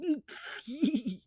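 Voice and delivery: high squealing laugh; high cackle. Retold or imitated. Cheeky teen after yelling back, high squealing laugh.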